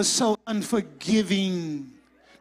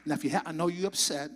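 softer, fast, calm customer-service voice; calm professional; 4 semitones lower, 3 dB quieter than usual. Pretend. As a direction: stay polite and even with the angry caller. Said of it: "I'm gonna say professional a hundred percent".

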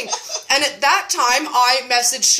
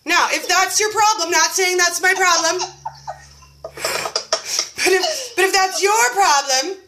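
higher, teasing sing-song hedge; coy video voice; 5 semitones higher than usual. Retold or imitated. In her house coat telling the group, coy and teasing. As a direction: wink at the viewers. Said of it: "I just made a video and I was like".

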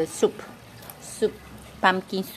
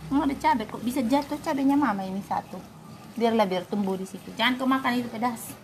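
ordinary talk

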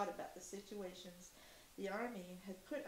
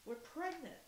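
chimed in unison; 6 semitones higher than usual. A narrator's reading. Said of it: "chimed in unison".

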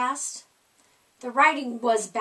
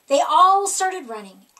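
ordinary delivery, nothing marked

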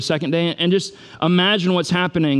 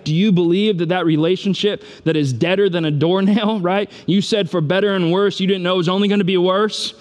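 higher, driving run of insistent questions; impassioned preaching; 4 semitones higher than usual. Real, not acted. Preacher urging the congregation to believe, impassioned. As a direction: build urgent challenge upon challenge.

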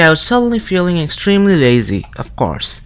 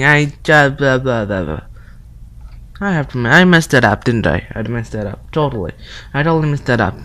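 ordinary talk, nothing marked

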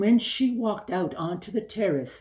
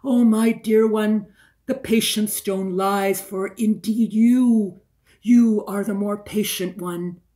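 higher and louder, pouring her heart out; impassioned plea; 3 semitones higher, 7 dB louder than usual. Acted. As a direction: cry it out to the stone, pleading. Rocking her, pleading to stone.

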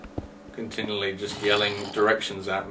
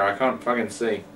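normal talking voice

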